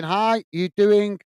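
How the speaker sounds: ordinary talk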